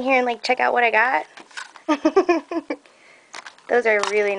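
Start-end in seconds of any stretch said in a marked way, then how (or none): 1.9-2.7 short high-pitched giggle; delighted giggle. Real, not acted. Delighted reaction to the gift.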